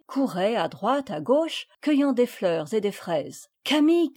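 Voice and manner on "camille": higher call to a friend. A narrator's reading. Madeleine calls Camille across the field, calling out. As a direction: call her over. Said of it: "s'écria Madeleine".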